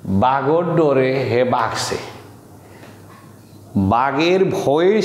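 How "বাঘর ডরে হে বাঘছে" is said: Sylheti demo. Shown as a demonstration. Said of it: "তাহলে দেখুন এখানে".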